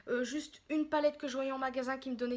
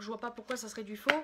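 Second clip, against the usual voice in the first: ordinary talk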